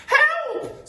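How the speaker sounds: very high, loud falsetto scream; Missus Twit screaming. Acted. Missus Twit feeling something in bed, screaming. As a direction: shriek for help. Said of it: "screamed Missus Twit".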